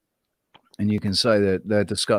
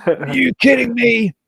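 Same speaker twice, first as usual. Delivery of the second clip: high, squeaky cartoon bug voice, indignant; leader cockroach voice; 8 semitones higher than usual. Leader cockroach protests to the roommate, high cartoon voice. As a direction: play an outraged little cartoon creature. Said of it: "the leader cockroach turns around and says, it's like Futurama".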